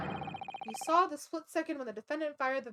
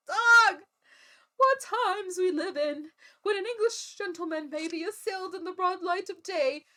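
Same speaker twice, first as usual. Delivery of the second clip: put-on outraged old gentleman; 4 semitones higher, 6 dB louder than usual. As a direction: bellow in pompous outrage. Old gent outraged in court.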